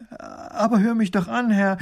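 high-pitched pleading cry; desperate. A narrator's reading. Woman begs the dismissive minister to listen, desperately. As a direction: beg to be heard, high and urgent. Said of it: "ruft die Frau verzweifelt".

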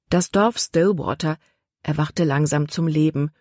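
ordinary delivery, nothing marked

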